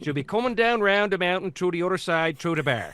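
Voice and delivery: hesitant Newfie accent, still learning the line; careful Newfie accent. Shown as a demonstration. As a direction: feel out every word in a Newfoundland lilt. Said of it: "Which turned into".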